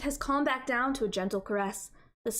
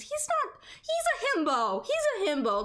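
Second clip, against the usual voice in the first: excited; 12 semitones higher than usual. Acted. Defending Cormac, high excited.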